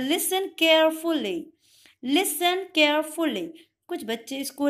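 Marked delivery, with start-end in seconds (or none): 0.0-3.5 slow, carefully spelled-out Listen carefully; clear listen carefully. Shown as a demonstration. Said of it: "उसे वो English में बोलते हैं". (धीरे, साफ़ उच्चारण में Listen carefully)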